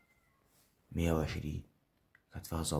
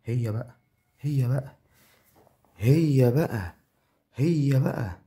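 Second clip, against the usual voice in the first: higher and much louder, building to a yell; excited screaming; 7 semitones higher, 10 dB louder, slower than usual. Real, not acted. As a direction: scream with joy at a big win. Big win in game.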